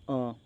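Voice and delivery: आ held three beats long; आ prolonged; drawn-out आ. Shown as a demonstration. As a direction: stretch the vowel आ. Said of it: "तो मैं तीन बार बोलूँगा ना".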